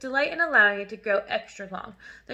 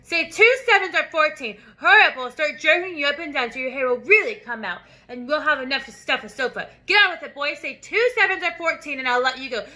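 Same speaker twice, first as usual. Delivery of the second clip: threatening; 3 semitones higher, 5 dB louder than usual. Acted. Headmistress threatening the dangling boy, loud and angry. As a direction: menace him until he says it.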